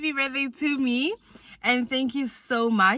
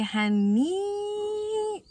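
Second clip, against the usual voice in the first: high, drawn-out sassy honey; a high sassy drawl on honey; 10 semitones higher than usual. Real, not acted. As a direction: toss it off with diva sass. Sassy aside to viewers.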